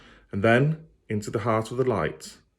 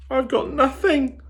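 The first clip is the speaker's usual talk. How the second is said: put-on much higher, breathless scared girl; gasped; 4 dB louder than usual. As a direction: blurt it out in fear. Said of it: "Lila gasped".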